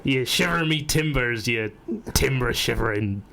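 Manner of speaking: slow, low pirate insult. Pretend. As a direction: snarl it like a pirate captain. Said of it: "I think that's the point".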